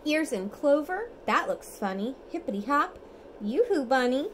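lively storybook reading, sing-song; reading to children. Acted. Parent reads bedtime picture book to toddler, lively sing-song. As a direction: read it brightly for a toddler at bedtime.

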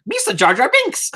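lightly voiced goofy alien impersonation; Jar Jar Binks. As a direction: slip into a slow, playful cartoon-character voice. Plays Jar Jar for the chat.

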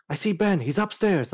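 high excited callout; imitating teammate callout. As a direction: shout it out to the team. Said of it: "I've heard way too many people go like".

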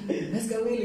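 high, loud mock fan cry; retold squeal of recognition; excited exclamation. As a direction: imitate a stranger thrilled at a star sighting. Retold fans spotting a celebrity lookalike.

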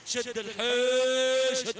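long, loud stretched call to push harder; drawn-out urging shout. Real, not acted. Commentator urging the camel owner on, long loud call.

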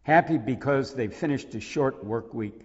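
poem reading. Acted. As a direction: read the poem's opening plainly but with care. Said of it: "Could you read the beginning of that?".

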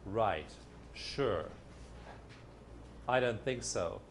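low, dismissive; sarcastic doubt. Shown as a demonstration. The real affirmation answering back, low and sarcastic. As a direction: brush it off with a low sneer. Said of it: "the real affirmation is".

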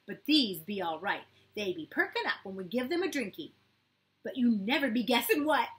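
put-on loud, high eager little boy; excited boy. A narrator's reading. Matt to Kira, loud excited child.

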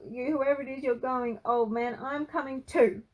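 loud, high, breathless-running rant; screamed tirade. A narrator's reading. Frankie loses it at granddad, screaming. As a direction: lose it and let rip. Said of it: "he screamed".

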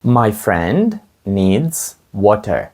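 very slow, each word laid out clearly; teacher's slow drill pace; slow model sentence. Shown as a demonstration. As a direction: say it slowly for learners to copy. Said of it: "Моєму другові потрібна вода".

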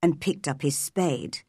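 ordinary delivery, nothing marked

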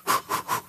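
imitated short puffs of breath; mimicked get-ready exhale; bracing breaths. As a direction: puff out as if bracing yourself. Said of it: "can I take a breath".

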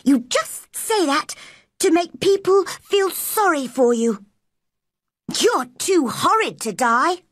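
high-pitched nasty accusation; disagreeable. Acted. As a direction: needle him in a high, disagreeable voice. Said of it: "in her most disagreeable voice".

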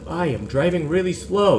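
normal talking voice

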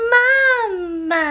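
put-on a high wailing baby saying mama; a mother voicing her baby's cry. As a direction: cry it out like an infant.

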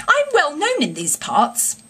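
"I'm well known in these parts" said high and lofty, rising at the end; a hint of the pompous don, bluffing; grand gentleman bluff. A narrator's reading. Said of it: "a blend of the squire and the college don".

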